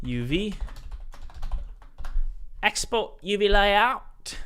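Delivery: slow, high mock Cockney drawl of "Layout"; Cockney accent. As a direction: put on a hammed-up Cockney accent. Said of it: "hard to say in a Cockney accent".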